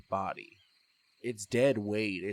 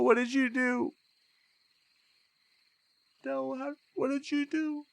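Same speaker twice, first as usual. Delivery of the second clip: high, loud, frantic pleading, rising questions; a fresh ghost begging his sister for answers in rising panic; panicked ghost; 12 semitones higher, 10 dB louder than usual. Acted. Ghost J J panics to Del over his own body, frantic and high.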